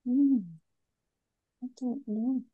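ordinary talk

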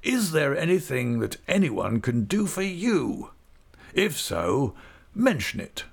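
a pompous magistrate showing off his prisoner; high, fussy official. A narrator's reading. Creakle asking Uriah what he wants in a high pompous voice.

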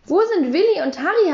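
a hint of a stern boy demanding answers; stern question. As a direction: ask it sternly, like an interrogation. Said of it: "hab ich streng gefragt".

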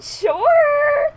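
high, loud, stretched squeal; mock-awkward, rising high yelp; retold uneasy agreement. Retelling her uncomfortable reaction.

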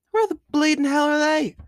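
captain shouting after his lost crew; alarmed shout. Acted. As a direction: yell out for the missing men. Captain missing crew members.

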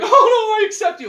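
high, laughing mock plea; hammed-up sinner at heaven's gate; fake penitent. Sinner begging God to let him in, high and laughing. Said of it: "I go up there like".

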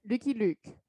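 ordinary talk

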